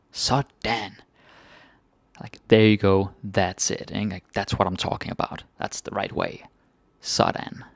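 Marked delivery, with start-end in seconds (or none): none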